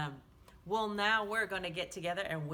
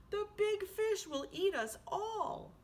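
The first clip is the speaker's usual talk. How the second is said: high, worried little fish voice; scared little fish; 10 semitones higher, 4 dB louder than usual. A narrator's reading. Little red fish warning Swimmy, higher worried voice. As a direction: squeak it out in fear. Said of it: "said the little red fish".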